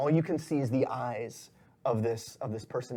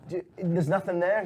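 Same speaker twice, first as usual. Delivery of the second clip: higher-pitched, faltering lackey voice; apologetic underling, voice raised in pitch; nervous henchman; 6 semitones higher than usual. Acted. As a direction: stammer excuses to the boss, pitched up. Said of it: "and, uh, he goes".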